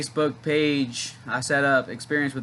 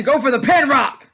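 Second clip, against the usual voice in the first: excited yell; 11 semitones higher, 9 dB louder than usual. Real, not acted. Urging wrestler while watching match.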